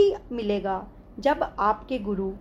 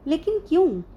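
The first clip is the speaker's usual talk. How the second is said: higher, louder puzzled question; surprised; 7 semitones higher, 5 dB louder than usual. A narrator's reading. Disciple questions guru's puzzling answer, surprised. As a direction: ask why, voice jumping up in surprise. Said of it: "मैंने हैरानी से पूछा".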